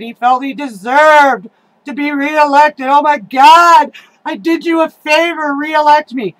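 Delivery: high, loud sneering mimic of an entitled official; mock the ungrateful ex-councilman; mocking whiny politician. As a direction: parody his whining for reelection.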